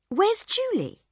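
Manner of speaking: high, startled question; suddenly worried about his wife; sudden alarm. Acted. Husband on the train, suddenly alarmed. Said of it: "Bill said suddenly".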